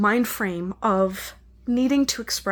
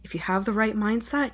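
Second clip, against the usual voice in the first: ordinary delivery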